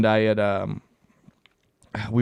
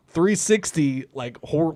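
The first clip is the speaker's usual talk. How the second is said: ordinary talk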